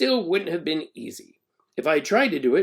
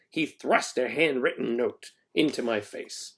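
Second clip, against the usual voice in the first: ordinary delivery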